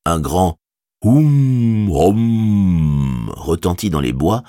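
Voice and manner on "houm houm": loud, hollow horn-like houm; deep horn call. A narrator's reading. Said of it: "tel un cor à la voix profonde".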